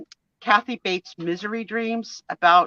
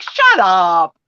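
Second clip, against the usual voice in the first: joking disbelief; 8 dB louder than usual. Shown as a demonstration. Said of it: "that's what people say when you say something funny".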